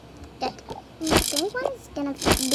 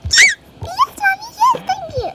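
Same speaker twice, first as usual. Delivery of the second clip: put-on very high, much louder child cheering; thrilled kid thanking Mommy; squealing little girl voice; excited child; 12 dB louder than usual.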